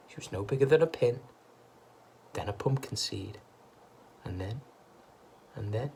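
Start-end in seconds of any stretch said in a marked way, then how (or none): none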